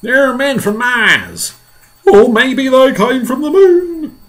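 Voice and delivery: high, loud, drawn-out cries; alarmed shouting. Acted. Onlookers guessing where the invaders came from, alarmed shouts.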